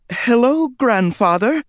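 high timid squeak; squeaky. Acted. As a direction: squeak it out nervously. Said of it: "I squeaked".

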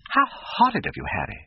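high-pitched cry of reproach, dropping on the name; shocked cry. A narrator's reading. Duchess scolding her friend for horrid talk, crying out. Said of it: "cried the Duchess".

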